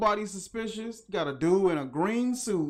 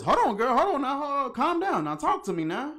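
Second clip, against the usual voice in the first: mock calming cop; 8 semitones higher, 4 dB louder, faster than usual. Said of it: "He says".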